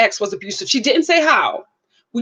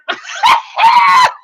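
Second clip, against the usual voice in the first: loud, high burst of laughter; cracking up at her own impression; high shrieking laugh; 6 dB louder than usual. Real, not acted. A vlogger cracking up at her own impression, shrieking with laughter.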